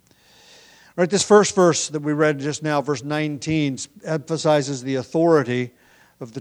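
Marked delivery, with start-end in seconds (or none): none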